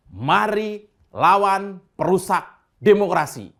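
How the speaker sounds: loud, slow call to fight; rallying shout. Real, not acted. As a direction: rouse the crowd to resist. Rallying cry against wreckers. (seruan lantang dan lambat)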